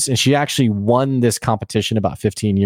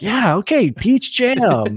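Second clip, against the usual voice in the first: much higher, hammed-up cheering crowd; mock crowd cheer; 10 semitones higher, slower than usual. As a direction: play the crowd going along with it, high and eager. Crowd cheering Dariax's answer.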